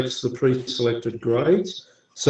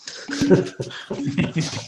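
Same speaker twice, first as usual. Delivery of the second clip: soft breathy laugh; 7 semitones higher, 5 dB quieter than usual. Real, not acted. Laughs after teasing Graham.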